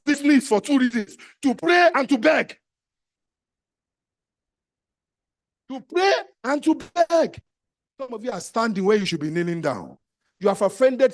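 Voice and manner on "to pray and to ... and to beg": each word slow and loud, stress on pray; slow emphatic repeat. Real, not acted. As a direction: land "pray" and "beg" slowly. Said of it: "repeats key phrase slowly".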